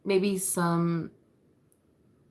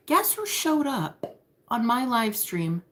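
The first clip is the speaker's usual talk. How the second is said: teasing sing-song; 5 semitones higher, slower than usual. Pretend. Teasing reveal.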